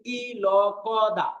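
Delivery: very slow, sound by sound; spelled out. Shown as a demonstration. Teacher showing students how to spell it, spelled out slowly. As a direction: break the word into separate drawn-out sounds. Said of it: "এইভাবে ... বলি".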